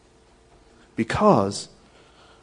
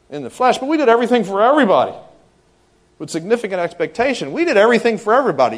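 higher, louder, fast; preacher hammering the point; rising emphatic preaching; 9 semitones higher, 11 dB louder, faster than usual. Real, not acted. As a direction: drive it home hard. Building emphatic rant.

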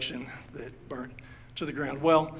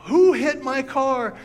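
higher, louder, slow angry yell; furious shout; 9 semitones higher, 10 dB louder, slower than usual. Retold or imitated. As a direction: bellow it, outraged over the dented car. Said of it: "he was furious".

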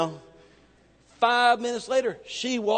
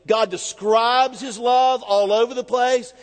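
ordinary talk

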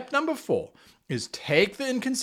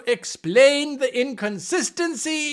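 higher, louder, slowing rallying cry; excited proclamation; 8 semitones higher, 5 dB louder than usual. Acted. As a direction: sell the idea like a big announcement. GM preaching his key rule.

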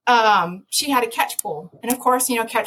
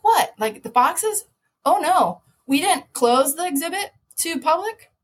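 alarmed realization; 7 semitones higher than usual. Retold or imitated. Realizing public watching.

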